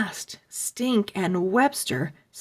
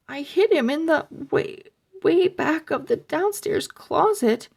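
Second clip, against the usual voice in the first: high, fast frightened babble; panicked; 7 semitones higher, faster than usual. Acted. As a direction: rush it out in fear. Panicked explanation.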